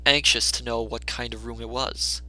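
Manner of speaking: usual speaking voice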